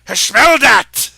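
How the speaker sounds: booming slow shout of the tavern keeper; loud bellow. Acted. Innkeeper bellowing toward the hidden door. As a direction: bellow it out slowly, nose in the air. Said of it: "and he goes".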